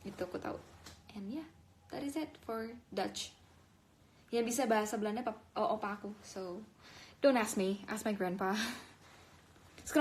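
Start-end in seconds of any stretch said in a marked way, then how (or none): none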